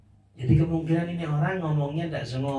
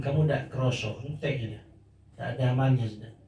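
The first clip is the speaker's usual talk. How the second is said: ordinary delivery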